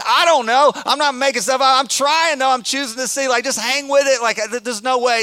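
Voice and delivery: high, loud, eager insistence; excited insisting. Retold or imitated. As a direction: push hard to keep spirits up. Insisting to kids in line.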